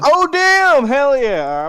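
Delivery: thrilled shout, high and loud; excited. Real, not acted. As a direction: whoop with sudden delight. Dash doubles flying distance.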